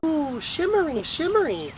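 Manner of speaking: put-on high, thrilled little-boy coo. A narrator's reading. Casey, trying on the skirt, cooing with joy. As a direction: coo it in delight like a small child. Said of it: "Casey said".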